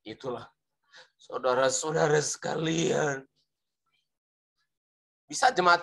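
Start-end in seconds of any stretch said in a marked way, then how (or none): 0.1-3.2 dramatize it to make the congregation cry; mock emotional preacher; dramatized preacher intonation. Shown as a demonstration. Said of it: "dengan dramatisir, lalu ditambah intonasi".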